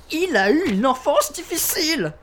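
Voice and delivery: very high-pitched indignant cry; defensive outburst. Acted. Suspect defending his killer brother, exploding.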